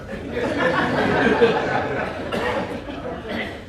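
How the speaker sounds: short airy laugh; real chuckle after the punchline; breathy laugh. Real, not acted. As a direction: laugh lightly at the joke.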